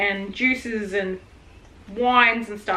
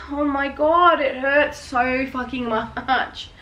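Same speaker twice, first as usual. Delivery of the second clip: normal talking voice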